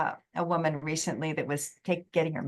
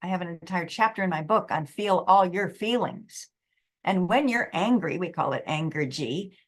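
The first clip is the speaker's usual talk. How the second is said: usual speaking voice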